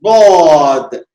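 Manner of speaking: बौद drawn out slow and loud, heavy; Medina dwad. Shown as a demonstration. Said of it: "मदीने के लोग बौद".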